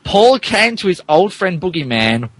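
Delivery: ordinary delivery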